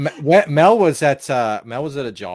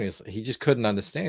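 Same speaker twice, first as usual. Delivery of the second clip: ordinary delivery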